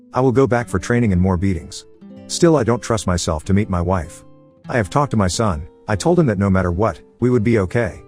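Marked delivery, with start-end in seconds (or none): none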